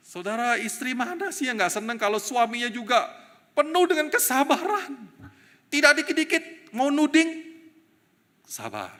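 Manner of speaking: preaching at full force; fervent preaching. Acted. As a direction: build the fire of the sermon. Preaching builds.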